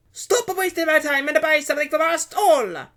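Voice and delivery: high, loud angry shout; barking, losing patience. A narrator's reading. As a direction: bark it at time-wasting customers. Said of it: "Carlotta barked, losing her patience".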